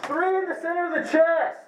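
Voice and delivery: loud, high, fast shout; yelling. Real, not acted. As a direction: yell it across the field. Yelling at opponent he hit.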